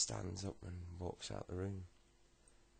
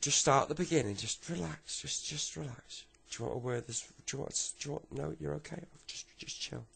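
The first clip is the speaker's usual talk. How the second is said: higher, insistent soothing patter; fussing granddad; 8 semitones higher, 6 dB louder than usual. Acted. Granddad urges Tommy to start his story, high fussing. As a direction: keep urging him to settle, pitched up.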